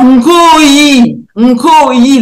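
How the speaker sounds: "Nkoyi" said slowly with the nasal N; Kikongo word. Shown as a demonstration. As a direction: give "Nkoyi" a clear nasal onset, slowly. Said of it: "le K se lit Nge". (« Nkoyi » prononcé lentement, N nasal)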